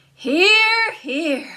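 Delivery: high, loud hear hear then falls; cheer. Acted. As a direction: cheer it out like Jack. Jack agrees.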